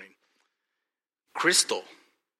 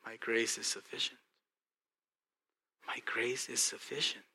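pondering quietly; 7 dB quieter than usual. Retold or imitated. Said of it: "So then I pause".

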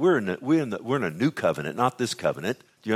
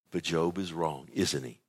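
usual speaking voice

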